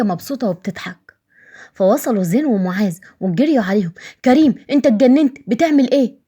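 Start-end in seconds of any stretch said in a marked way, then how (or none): 4.2-6.2 louder, higher scolding yell; angry shout. Acted. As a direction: tell him off in fury. Brothers catch Karim hitting child. (زين ومعاذ بيزعقوا لكريم بغضب)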